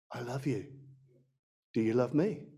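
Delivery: tender. Retold or imitated. God's quiet voice.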